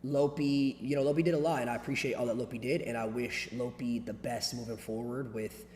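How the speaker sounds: quiet, subdued and somber; soft, measured, rehearsed regret; somber scripted apology. Real, not acted. Somber farewell to departed friend.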